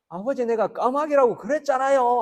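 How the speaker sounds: exasperated son snapping loudly at his father; angry outburst. Retold or imitated. Grown son losing patience with his old father, angry. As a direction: blurt it out in irritation, high and loud. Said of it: "화를 벌컥 내더라고".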